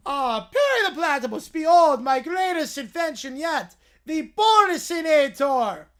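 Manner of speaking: booming cartoon villain unveiling his invention; Doofenshmirtz villain voice. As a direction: ham up the evil scientist's big reveal.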